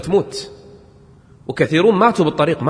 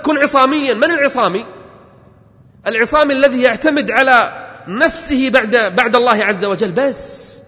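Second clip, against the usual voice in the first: high, loud fiery exhortation; preaching at full force; 8 semitones higher, 5 dB louder than usual. Acted. Preacher urging the listeners not to make excuses, fiery and loud. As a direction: hammer each don't-say home to the crowd.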